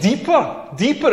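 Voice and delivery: lightly mocked demand. Comic imitating his date in bed, mild pleading demand. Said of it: "She's like".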